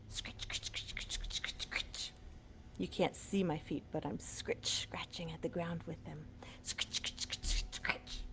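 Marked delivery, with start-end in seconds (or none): 0.1-2.1 soft whisper, scratchy sounds; whispered scratching. Acted. Showing kids a bird scratching the ground, whispered. 3.9-8.2 soft whisper, scratchy sounds; whispered scratching. Acted. Showing kids a bird scratching the ground, whispered.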